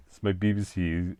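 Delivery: lightly mock BBC newsreader accent; BBC English accent. Shown as a demonstration. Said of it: "Queen's English".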